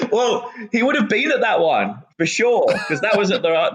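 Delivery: high-pitched talk through real laughter; amused, laughter bubbling through the words; laughing. Real, not acted. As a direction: answer while cracking up. Laughs at host's joke.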